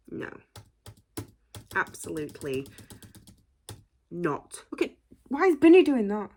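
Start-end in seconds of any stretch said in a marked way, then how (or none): 4.7-6.3 high loud exclamation; disbelieving shout. Real, not acted. Reacting to member in video.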